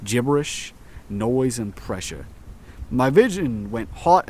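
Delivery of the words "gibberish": ordinary delivery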